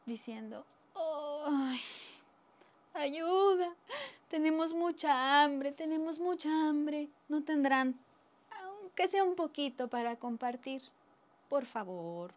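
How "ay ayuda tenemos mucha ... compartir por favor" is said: drawn-out, higher-pitched begging wail; pleading poor folk. Acted. Hungry poor begging the rich for food, plaintive pleading. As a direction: beg pitifully, stretching the words. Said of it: "cuando se acercaron los pobres, diciendo".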